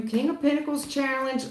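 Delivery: ordinary delivery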